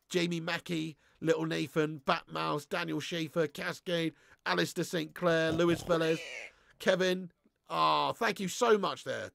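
usual speaking voice

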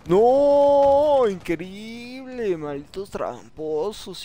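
loud high no, then a slow increíble; outraged disbelief. Real, not acted. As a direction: cry out in disbelief. Cheated by hackers in game.

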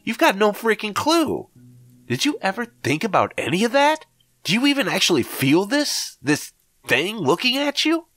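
high, panicky disbelief; alarmed high voice. Acted. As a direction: pitch way up in frightened protest. Narrator alarmed at friend.

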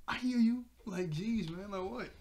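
high opening, mock annoyance; imitated fed-up snap; exasperated retort. Replaying Miko's annoyed comeback.

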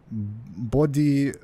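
put-on a first stab at a French accent; botched mock French accent; French accent attempt. Player starts the note for immersion, attempted French accent. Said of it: "do a French accent".

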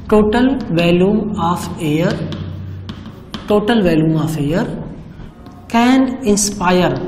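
very slow, stretched phrases with pauses between; deliberate, drawn-out definition reading. Shown as a demonstration. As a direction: dictate each phrase slowly to the class. Reads definition slowly for pupils.

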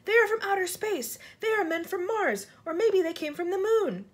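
high, excited crowd voices; excited shouting. A narrator's reading. Crowd guessing about the living things, excited shouting. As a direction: exclaim it in wild excitement. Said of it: "they shouted".

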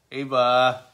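ordinary delivery

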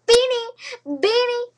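high, loud giggly squeal; playful squeal. Pretend. Repeating her hamster's name in a silly high squeal.

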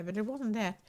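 ordinary talk

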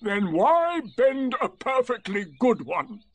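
slow, high, aggrieved donkey voice; Eeyore. A narrator's reading. Donkey protesting over his thistles, slow and aggrieved. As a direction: complain in loud drawn-out words. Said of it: "asked Eeyore".

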